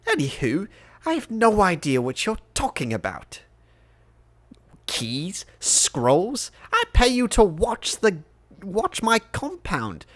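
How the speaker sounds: exasperated master, pitch leaping high on the questions; Lord Scheinheimer, indignant. Acted. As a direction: berate a hired guard as a fussy, affronted lord.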